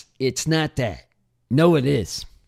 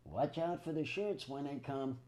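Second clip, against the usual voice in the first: dictating into phone; 18 dB quieter than usual. Real, not acted. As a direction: speak clearly into the held-up phone. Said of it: "He's gonna talk into the phone".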